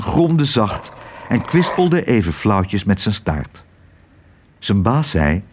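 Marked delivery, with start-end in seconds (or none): none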